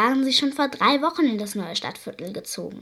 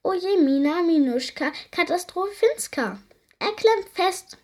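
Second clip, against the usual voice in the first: whiny creature; 8 semitones higher, 3 dB louder than usual. A narrator's reading. Creature laments stuck arm.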